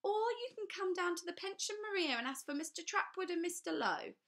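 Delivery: talking down as if they had lost their wits; sinister official. A narrator's reading. Trapwood warns the children to go to the police.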